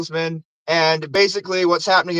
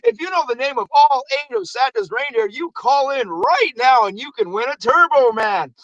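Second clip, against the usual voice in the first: higher-pitched contest announcer sing-out; radio announcer; 6 semitones higher than usual. Retold or imitated. Radio host announcing a toy contest to listeners, excited announcer voice. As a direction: hype up a radio giveaway. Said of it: "over the radio".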